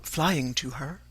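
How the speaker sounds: plain reading voice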